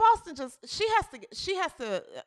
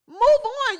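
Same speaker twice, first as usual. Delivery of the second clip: emphatic; 7 semitones higher, 5 dB louder than usual. Real, not acted. Ranting at the player.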